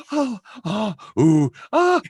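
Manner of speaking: acted breath-heavy oohs and ahs; mock moaning. Imitating soft porn voice work.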